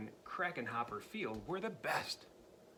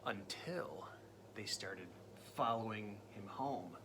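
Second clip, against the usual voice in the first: suspense whisper; 6 semitones lower, 6 dB quieter than usual. A narrator's reading. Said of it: "suspense build in story".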